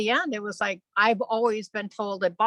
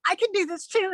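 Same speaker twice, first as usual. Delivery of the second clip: put-on high, childlike claim; little kid; 9 semitones higher than usual. Twelve-year-old telling the laughing group, childlike. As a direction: pitch it up like an eager kid. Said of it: "like a little kid would".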